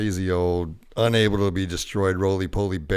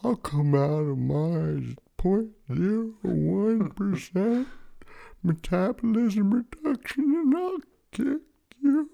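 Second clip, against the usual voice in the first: put-on belligerent drunk; 11 semitones higher, slower than usual. Water bear threatens, rising.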